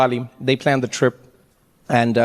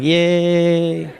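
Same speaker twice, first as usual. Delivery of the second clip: sarcastic yay; 2 semitones lower than usual. Retold or imitated. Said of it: "And I'm like".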